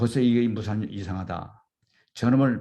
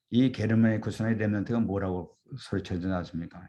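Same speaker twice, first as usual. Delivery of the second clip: ordinary talk, nothing marked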